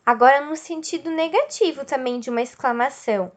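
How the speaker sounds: ordinary talk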